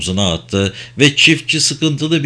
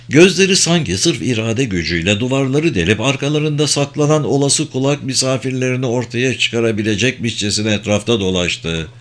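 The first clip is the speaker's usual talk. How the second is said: plain reading voice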